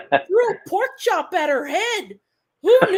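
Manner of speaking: imitating a Canadian uncle. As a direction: marvel at the gossip like the Canadian uncle. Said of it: "my Canadian uncle is always funny".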